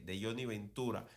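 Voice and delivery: ordinary delivery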